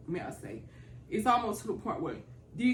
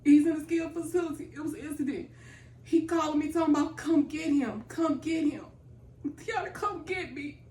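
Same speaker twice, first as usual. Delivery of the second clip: much higher, tearful, voice cracking; fighting back tears as she tells it; breaking down; 9 semitones higher than usual. Real, not acted. Caregiver recalling her father's crying call, tearful. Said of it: "I just melt down and I try to hold it in".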